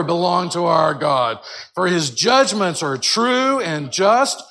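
Alleluia called out loud and slow; crying out. Shown as a demonstration. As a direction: proclaim it like a crowd in heaven. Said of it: "You're getting there".

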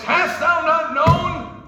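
slow, high and shouted; shouted fatherly rebuke; dad's voice. Retold or imitated. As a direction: boom it like a father. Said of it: "I can hear my dad's voice".

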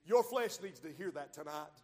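normal talking voice

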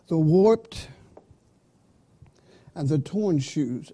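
WARPED hit loud, long pause before "and the torn"; two separate items, WARPED punched and left hanging; two-item phrasing. Shown as a demonstration. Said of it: "at least two shoes".

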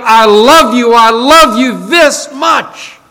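rising to a loud, impassioned call, slowing on this much; crying out. Acted. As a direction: preach it out full voice, dragging the last words. Preacher cries out Jesus' love.

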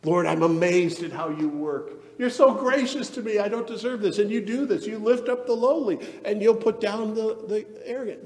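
high-pitched, wondering praise to God; amazed praise. Acted. Barren woman Hannah praising the Lord, amazed. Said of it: "she's not singing about her child".

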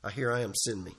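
soft, low surrender to the Lord; humble reply. Pretend. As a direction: answer God quietly, yielding. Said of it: "And you say".

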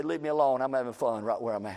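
dropping low and soft, unbothered; quiet retort. Retold or imitated. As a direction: brush them off softly, almost to yourself. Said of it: "I say".

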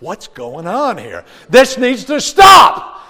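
slow, rising to a high, loud shout; mock shout. Father stopping the complaining at dinner, slow loud shout. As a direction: bark it slowly to stop the table talk. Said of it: "And I'm like".

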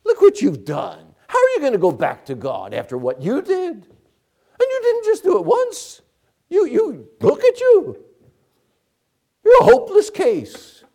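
mocking, rising to a high loud jeer; Satan taunting. Satan taunting the fallen sinner, high mocking jeer. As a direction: sneer at the sinner like the devil. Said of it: "Satan says".

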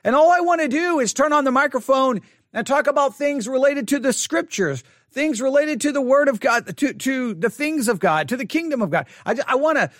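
high, pleading prayer, voice rising with distress; emotional plea to God. Retold or imitated. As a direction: beg the Lord to take it away. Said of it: "I start getting emotional".

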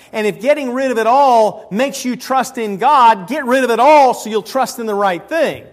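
fervent preacher's build; preaching at full force. Real, not acted. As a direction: preach it hard to the congregation.

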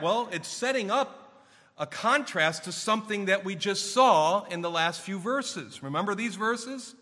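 ordinary talk

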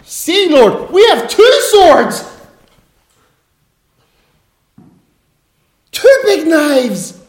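high, loud, excited boast; mock-eager disciples shouting their find. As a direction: show off the swords like proud boys.

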